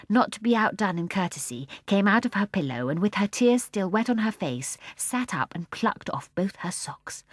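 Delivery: plain reading voice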